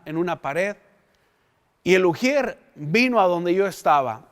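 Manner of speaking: ordinary delivery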